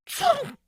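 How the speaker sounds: loud high sneeze, muffled attempt failing. A narrator's reading. Hiding in the closet, Nancy sneezes loudly. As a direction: burst out with a big sneeze. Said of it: "She tried to muffle the sound".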